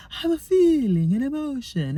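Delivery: lightly voiced Deanna Troi line; playful inside-joke quote of Deanna; Star Trek quote. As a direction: quote it with a knowing lilt. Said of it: "It's an inside joke if you watch Star Trek".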